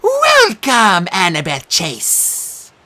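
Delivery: high, loud, slow showbiz welcome; game show host. Acted. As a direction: roll out the contestant's name. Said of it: "the monster cried".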